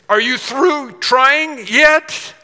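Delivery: slow, high and loud, booming divine voice; a heavenly voice calling down, drawn out; voice of the Lord. Retold or imitated. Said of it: "the Lord could come to me and say".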